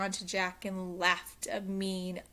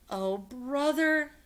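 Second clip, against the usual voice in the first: exasperated; 7 semitones higher, 5 dB louder, slower than usual. A narrator's reading. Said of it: "thought Jack".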